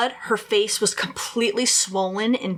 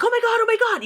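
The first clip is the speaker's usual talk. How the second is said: mock shouting; 13 semitones higher, much faster than usual. Caller reporting bodies to the dispatcher, imitated frantic shouting. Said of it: "shouting this, you know, just like".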